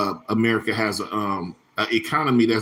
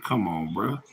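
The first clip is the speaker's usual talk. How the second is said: a lower, softer mock Italian-American "Come on, bruh"; 4 semitones lower, 6 dB quieter, slower than usual. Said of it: "that's what them Italians be saying".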